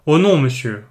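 a lightly voiced stern, pious old maid; pious and hard. A narrator's reading. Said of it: "avec son air dévot et dur".

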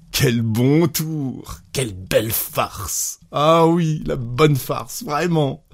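tickled and delighted; gleeful amusement. A narrator's reading. Husband laughing at the thought of the prank, gleeful. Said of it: "se mit à rire à cette pensée".

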